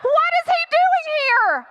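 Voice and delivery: high-pitched, escalating panic; hyperventilating panic. Acted. Girlfriend dreads divorced parents meeting, panicking. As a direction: spiral upward into hammed-up freak-out. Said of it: "I start hyperventilating".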